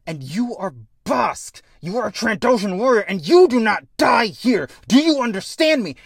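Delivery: high, loud, emphatic acted pep talk; building impassioned demand; fierce rallying shout. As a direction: drive every word into a panicking friend. Kid rallies panicking warrior.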